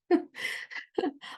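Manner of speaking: laugh. Real, not acted. Laughing off the sales pitch.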